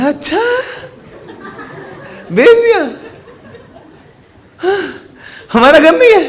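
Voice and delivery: high, halting disbelief; astonished relief. Acted. Crying father learns the house was sold, astonished. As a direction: squeak it out between long stunned pauses. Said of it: "भाईजान जो रो रहे थे".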